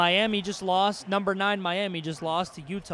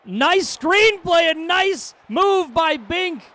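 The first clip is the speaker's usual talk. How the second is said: loud, high, slow sports yell; drawn-out hype call; excited shout; 12 semitones higher, 8 dB louder, much slower than usual. Acted. Big play, excited shout.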